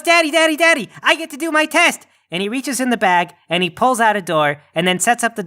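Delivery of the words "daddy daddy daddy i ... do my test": put-on high, eager little boy calling his dad; excited kid. Krampus Junior running to his father, high and eager. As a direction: bounce on it like a thrilled child. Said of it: "and he goes".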